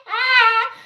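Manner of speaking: high, loud mimicked Southern "Hi"; copied Georgia accent. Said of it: "I be trying to copy 'em".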